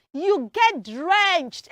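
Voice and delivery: "drenched" punched loud and drawn out; slow right down and hit "drenched"; stress on drenched. Shown as a demonstration. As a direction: teach "drenched" with high, loud emphasis. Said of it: "say drenched".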